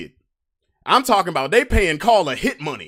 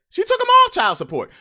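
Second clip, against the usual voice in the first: much higher and louder on the twist; excited high punchline; surprised emphasis; 7 dB louder than usual. Real, not acted. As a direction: sell the surprising turn.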